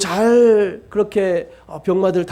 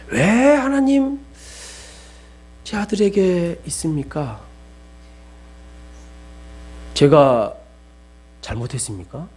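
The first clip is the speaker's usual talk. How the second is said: retold lament. Father lamenting to God over his son's surgery, pleading. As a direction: ask God why, breaking. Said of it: "주님을 많이 원망했던 것 같습니다".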